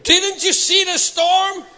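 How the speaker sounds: high, loud, drawn-out acted outcry; incredulous shout. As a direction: yell it in disbelief at someone who missed the obvious. Peter protesting to Jesus.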